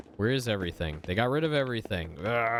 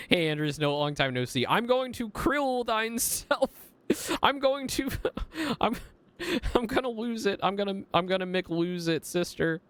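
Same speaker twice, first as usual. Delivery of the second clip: squeaky mock threat; 12 semitones higher than usual. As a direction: squeak the threat until the giggles take over. Mocking the nun's anger, laughing.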